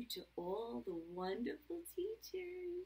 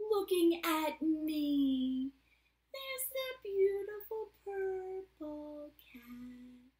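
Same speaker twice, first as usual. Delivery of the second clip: refrain lifting into a higher, slow answer; reading to children; 4 semitones higher, 10 dB louder, much slower than usual. A narrator's reading. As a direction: draw it out sing-song for kids. Reads picture book aloud.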